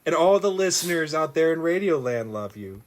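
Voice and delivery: ordinary talk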